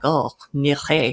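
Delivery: put-on a light French accent on "Nire"; a hint of a French accent; French friend. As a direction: say it like a Frenchman, easy and sure. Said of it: "he's like".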